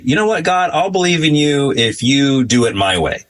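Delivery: mocking demanding believer. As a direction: act out a demanding prayer. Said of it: "people will say".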